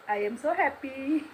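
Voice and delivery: very slow, drawn out in English; a mock-proud English line, very slow; playful English. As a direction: savour each word as if showing off her English. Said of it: "English बोल रही है आपकी बहन".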